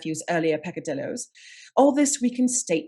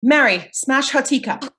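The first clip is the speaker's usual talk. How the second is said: urgent command; 5 semitones higher, 6 dB louder than usual. Acted. Urgent order to stop poison.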